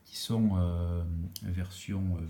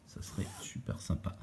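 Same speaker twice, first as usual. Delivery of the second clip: whispering; 3 semitones lower, 10 dB quieter than usual. Real, not acted. Whispered aside.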